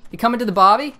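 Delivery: high, quick mock-Australian invitation; hammed-up Australian accent. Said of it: "Australians hate that".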